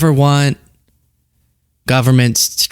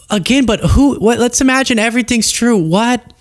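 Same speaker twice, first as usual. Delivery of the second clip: high, baffled questioning; incredulous; 9 semitones higher than usual. Real, not acted. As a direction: pitch shooting up in disbelief. Host baffled by the claims.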